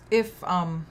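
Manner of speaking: usual speaking voice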